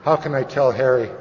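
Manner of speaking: ordinary talk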